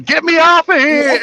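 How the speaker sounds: high, drawn-out panicked yell in imitation; hammed-up scream for rescue, stretched out slowly; mock screaming. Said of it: "He would have been screaming".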